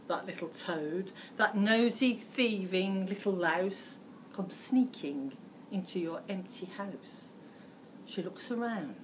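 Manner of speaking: plain reading voice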